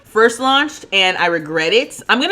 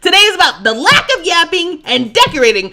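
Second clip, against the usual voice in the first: playful mock rant; 8 semitones higher, 6 dB louder than usual. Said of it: "respectfully, stop holding me accountable".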